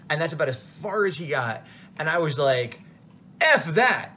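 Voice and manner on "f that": loud, higher retold blurt; retold outburst. To the convention cleaning guy over a fifty-dollar fee, a loud retold blurt. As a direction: let it burst out at the cleaning guy. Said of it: "I don't mean to yell at you".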